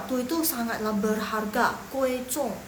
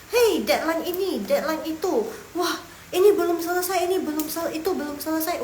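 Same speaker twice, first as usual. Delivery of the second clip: higher, louder flustered rush; mock office panic; mock deadline panic; 6 semitones higher, 3 dB louder than usual. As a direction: act swamped by deadlines. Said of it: "acted office panic over deadlines".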